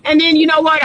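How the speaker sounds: ordinary delivery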